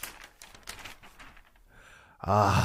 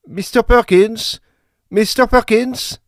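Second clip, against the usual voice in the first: higher, louder call; 9 semitones higher, 6 dB louder than usual. Acted. Maestro calls the conductor about missing scores, loud and high. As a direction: call down the corridor for help.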